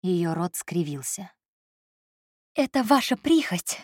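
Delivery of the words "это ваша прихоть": higher, halting breaths, slowing; breathless. A narrator's reading. Secretary hurt by him, breathless and higher. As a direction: gasp the words out between breaths. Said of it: "прерывисто выдохнула она".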